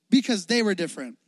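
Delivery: normal talking voice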